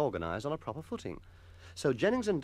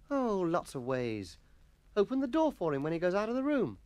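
schoolboy explaining; 7 semitones higher than usual. Acted. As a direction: instruct your mate in a boy's voice. Jennings explains to Atkinson.

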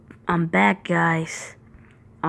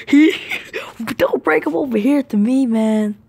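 panicky pleading; 4 semitones higher, 5 dB louder than usual. Real, not acted. Seeker nearby, panic.

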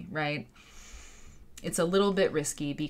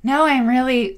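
loud, higher mock protest; mock insistence; 7 semitones higher, 11 dB louder than usual. Explaining how to read people, mock insistent. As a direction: insist far too hard, imitating someone insincere. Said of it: "what they really mean when they're saying".

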